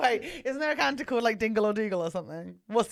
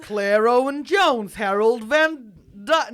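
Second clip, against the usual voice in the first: loud, drawn-out Texan attempt; Texan accent; 8 dB louder than usual. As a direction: belt the names in shaky mock Texan.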